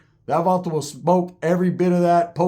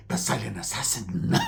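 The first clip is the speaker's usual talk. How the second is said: low, hushed mock-stealthy voice; silent assassin; 7 semitones lower, 8 dB quieter than usual. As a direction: say it low and secretive, hamming it up. Mocking his own silent assassin role.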